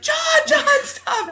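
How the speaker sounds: high, loud mock shriek for him to stop; panicked plea. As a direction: shriek his name in alarm. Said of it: "she's like".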